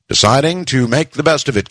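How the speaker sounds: usual speaking voice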